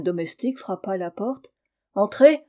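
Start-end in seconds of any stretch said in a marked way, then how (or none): 1.9-2.4 loud booming call to the door; thundering voice. A narrator's reading. Husband booming at the servant, loud and commanding. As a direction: boom out the single word. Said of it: "dit Gaspard d'une voix formidable".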